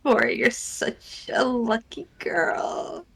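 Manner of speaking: slow, whimpering sob; fake crying. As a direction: wail it out through mock tears. Repeats line, now sobbing slowly.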